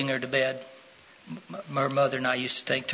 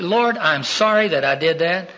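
raised, louder plea building to a strong cry; acted pleading; 6 semitones higher, 6 dB louder than usual. Preacher models a sinner praying to God, pleading. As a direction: act out pleading for forgiveness. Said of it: "That attitude that's pleading with God".

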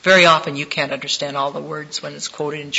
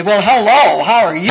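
loud, high, booming hello; loud brassy greeting; 6 semitones higher, 14 dB louder than usual. Retold or imitated. Booming hello bursting into the sick boy's room. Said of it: "couldn't just walk into his room and say".

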